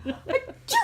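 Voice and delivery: high, playful pretend sneeze; fake sneeze. Mom fakes a sneeze for baby, high and playful. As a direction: sneeze big to make the baby laugh. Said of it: "We're gonna laugh now".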